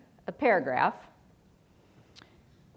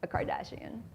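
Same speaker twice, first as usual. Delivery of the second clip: vocal fry; 3 semitones higher, 8 dB quieter than usual. Retold or imitated. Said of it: "the vocal growl where somebody talks like".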